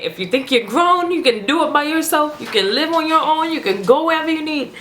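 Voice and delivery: imitated scolding, pitched high; parent's rant. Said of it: "parents are going that whole rant".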